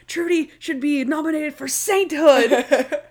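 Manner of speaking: high, exasperated mock outburst; imitated freak-out. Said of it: "And then Stottlemyre freaks out".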